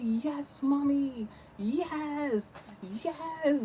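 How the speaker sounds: high, held-in cheer; silent inner cheering. Retold or imitated. Daughter secretly cheering on mom's telling-off, held-in high cheer. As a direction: squeal your approval under control. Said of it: "on the inside".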